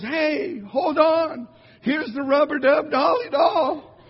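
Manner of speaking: high, loud, eager helper offering a useless toy; mock cheerful rescuer. To a drowning man, a silly rescuer calling out high and loud. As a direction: call it out brightly like help has arrived, slowing on the doll's name.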